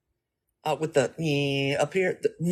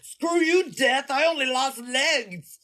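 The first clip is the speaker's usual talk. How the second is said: mock defiance; 8 semitones higher, slower than usual. Defiant quote to death.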